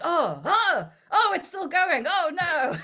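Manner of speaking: high, loud horrified oh's, half laughing; imitating horrified viewers; mock horrified audience. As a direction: squeal like a cinema crowd watching a gory death. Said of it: "everyone's just like".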